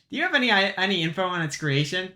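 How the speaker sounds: ordinary delivery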